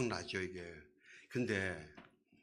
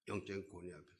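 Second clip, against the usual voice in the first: 영적인 권위 앞에서 repeated much softer, like an afterthought; soft echo; 5 semitones lower, 10 dB quieter, much faster than usual. Shown as a demonstration. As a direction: let 영적인 권위 앞에서 fall away quietly. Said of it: "그 영적인 권위 앞에서".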